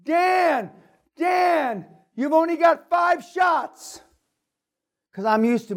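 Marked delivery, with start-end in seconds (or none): 0.0-4.0 high shouted warning, slowing down; yelling warning. Retold or imitated. Guide shouting a warning to the hunter. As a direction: call out urgently to a friend. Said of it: "Barry's yelling at me".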